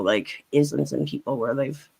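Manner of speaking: ordinary talk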